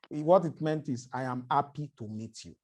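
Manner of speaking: ordinary delivery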